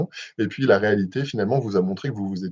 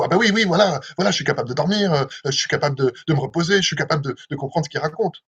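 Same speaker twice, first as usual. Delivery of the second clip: higher-pitched, hurried and unconfident; stressed voice; 5 semitones higher, faster than usual. Shown as a demonstration. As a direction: reassure yourself in a stressed, pressured voice. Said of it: "faites une voix un peu stressante".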